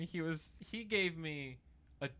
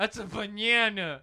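mock insistence; 7 semitones higher, 12 dB louder than usual. Playful insistence, laughing back.

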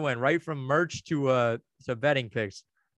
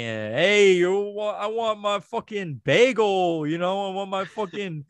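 put-on higher-pitched caricature of a New York accent; mock New Yorker; 5 semitones higher, 4 dB louder, slower than usual. Podcaster mocking New Yorkers he can't stand, caricatured New York accent. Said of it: "they're all fucking".

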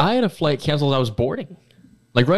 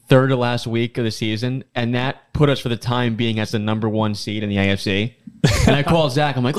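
usual speaking voice

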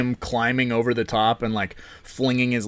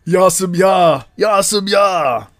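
played backwards; 7 semitones higher, 7 dB louder, slower than usual. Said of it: "That sounds like a record played backwards".

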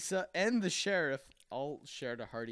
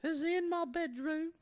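put-on much higher, prim little boy; 3 dB louder than usual. Little boy questioning the priest in a high prim voice. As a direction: play a polite, innocent little boy. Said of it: "He says".

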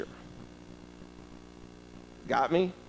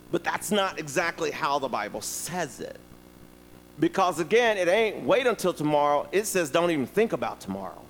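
usual speaking voice

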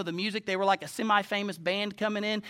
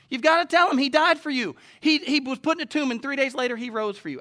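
raised, urgent pulpit proclamation; full-force preaching; 6 semitones higher, 5 dB louder than usual. Real, not acted. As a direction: press the message on the congregation with rising fervor.

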